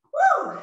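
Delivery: high, loud whoop of relief; relieved whoop. Real, not acted. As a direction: let out a triumphant high whoop. Whoop after finishing the set.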